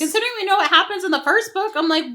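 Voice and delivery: high, loud incredulous rant; exasperated disbelief. Real, not acted. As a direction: protest the absurd choice at the top of your voice. Outraged reaction to the plot.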